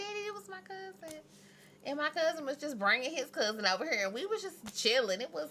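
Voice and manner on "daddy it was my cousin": put-on pleading child. Pleading with dad for phone.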